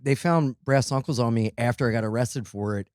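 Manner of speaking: ordinary talk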